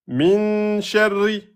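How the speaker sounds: "Min sharri" drawn out, noun held; slow recitation. Shown as a demonstration. Teacher reciting Quran phrase to class. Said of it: "une rouna deux temps sur le noun".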